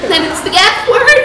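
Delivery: usual speaking voice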